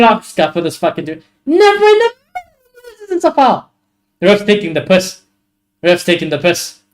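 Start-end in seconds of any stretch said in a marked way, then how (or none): 1.5-3.7 very high, squealed disbelief; outraged squeal at a bad call; incredulous protest. Real, not acted. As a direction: voice shooting right up in indignation. Repeating protest at the foul call.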